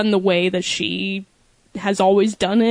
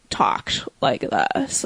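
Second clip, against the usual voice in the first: low, slow creaky fry; vocal fry; 9 semitones lower, 4 dB quieter, much slower than usual. Shown as a demonstration. Mocking a coworker at Target in vocal fry. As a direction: creak the words out slowly. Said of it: "vocal fry".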